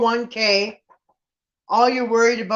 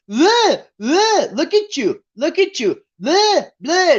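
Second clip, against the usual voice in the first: high, taunting mock whine, repeated; 8 semitones higher, slower than usual. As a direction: taunt her like a bratty kid pulling faces. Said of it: "then he mocked her".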